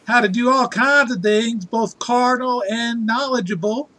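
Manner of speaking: exaggerated Southern yokel voice; Alabama accent. [name], you sound so Alabama.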